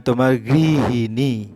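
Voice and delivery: গৃহিণী drawn out slowly, each syllable গৃ হি ণী distinct; teacher modelling গৃহিণী slowly for the class; careful pronunciation. Shown as a demonstration. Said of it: "এভাবে উচ্চারণটা হবে".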